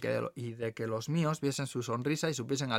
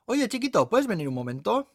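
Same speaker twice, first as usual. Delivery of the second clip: high, louder waitress voice; waitress calling over; 9 semitones higher, 5 dB louder than usual. Acted. Waitress calls the young man aside, high and coaxing. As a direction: call him over, pitched up.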